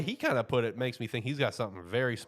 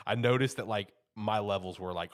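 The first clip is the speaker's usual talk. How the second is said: ordinary talk, nothing marked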